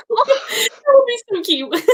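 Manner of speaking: high, slow gushing squeal; a delighted near-cry squeal. Real, not acted. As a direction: coo over something adorable. Squealing over baby Grogu armor.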